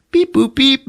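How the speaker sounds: high-pitched beep-boop imitation; electronic beeping. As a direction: imitate gadget buttons in high tones. Imitates arming a grenade.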